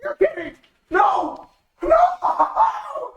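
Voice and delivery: high, slow, disbelieving wail; can't accept what just happened; real shock, voice up high; stunned disbelief. Real, not acted. Disbelief at death.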